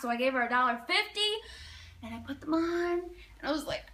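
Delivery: ordinary delivery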